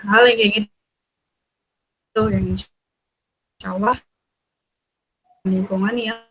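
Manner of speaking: choppy broken connection; breaking up space radio. Real, not acted. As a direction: voice cutting in and out. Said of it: "Suaranya putus-putus nih". (suara putus-putus)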